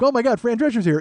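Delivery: loud, high starstruck gush; hammed-up thrill at spotting a celebrity; excited. LA diner spotting a celebrity, excited. Contrasting LA reaction to New York.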